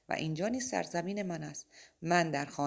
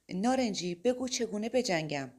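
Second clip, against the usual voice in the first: urgent plea; 5 semitones higher, 4 dB louder than usual. Acted. Mike pleads with Orange.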